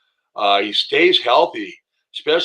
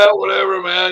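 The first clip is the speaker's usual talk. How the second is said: gravelly Motown voice impression; 7 semitones higher, 4 dB louder than usual. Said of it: "He's got that voice".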